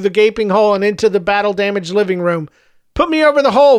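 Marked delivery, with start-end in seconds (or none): 2.9-3.8 high-pitched urgent order; understated cracking scream. A narrator's reading. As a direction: order it in a raised, straining scream. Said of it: "Visser screamed, his voice cracking".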